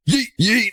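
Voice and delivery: high, thin squeal on each yeet; squeaky yeet. Pretend. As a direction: toss the word up high. Tossing items, squeaky voice.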